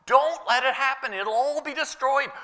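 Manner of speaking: high-pitched urgent plea; alarmed retold warning. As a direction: warn them with pitch pushed right up. Acting out the apostle's alarm.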